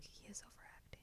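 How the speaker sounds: barely audible, voiceless whisper; whispered thought. Retold or imitated. Viewer to self during every movie, whispered thought. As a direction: whisper it as if to yourself.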